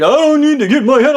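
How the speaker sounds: high, loud mock protest; hammed-up defensive outburst; indignant retort. Manager snaps back at worker's advice, indignant protest.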